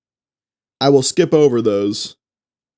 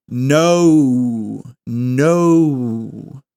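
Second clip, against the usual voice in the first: no stretched long; even slower. Shown as a demonstration. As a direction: drag out no to show both vowels. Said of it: "slow it down even more".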